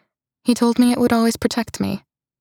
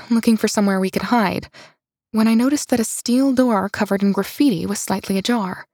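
plain reading voice

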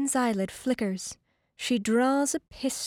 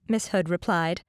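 plain reading voice

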